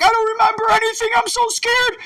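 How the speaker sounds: high, fast, mock-frightened whimper; scared inner voice. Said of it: "you're like".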